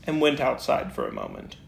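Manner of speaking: ordinary delivery, nothing marked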